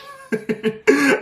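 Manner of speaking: high-pitched burst of laughter; real high giggle; laughing. Real, not acted. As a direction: crack up at your own silly term. Laughs at own coinage.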